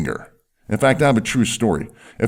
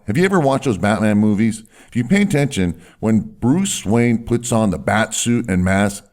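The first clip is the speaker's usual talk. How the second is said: normal talking voice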